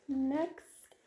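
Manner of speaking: ordinary delivery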